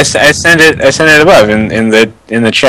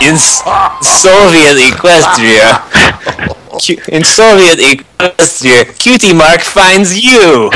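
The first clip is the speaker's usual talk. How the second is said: put-on Russian accent; 3 semitones higher, slower than usual. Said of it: "a thick Russian accent too".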